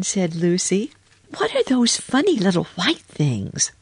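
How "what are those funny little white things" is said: put-on high, inquisitive girl voice; curious little girl. A narrator's reading. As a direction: ask with high-pitched childlike curiosity. Lucy asks about the laundry.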